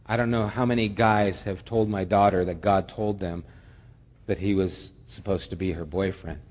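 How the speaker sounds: usual speaking voice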